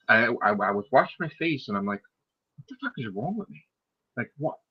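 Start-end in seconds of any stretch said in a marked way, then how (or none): 2.6-4.6 much softer retold aside; muted self-questioning. Teller washing his face afterwards, quiet self-questioning. Retold talking to himself in back room.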